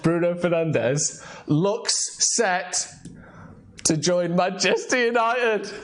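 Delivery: slow, drawn-out big announcement; dramatic headline announcer. Pretend. Host announces the main story, dramatic announcer. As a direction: stretch it out like breaking news.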